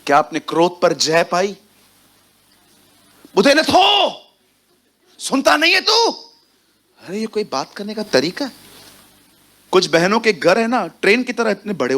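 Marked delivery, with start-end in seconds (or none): 3.3-6.1 mock angry shout. Shouting at a deaf person. 6.1-8.5 much softer, slowed reproach; soft rebuke. Acted. To the congregation, chiding the shouter in a much softer voice.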